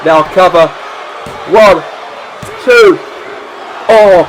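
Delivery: wrestling commentator. Acted. Live play-by-play of the match.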